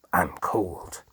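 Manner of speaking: ordinary delivery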